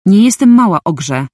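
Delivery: loud, high angry retort; a real burst of anger, loud and high; angry snarl. Acted. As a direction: snap back at him furiously. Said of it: "warknęłam wściekła".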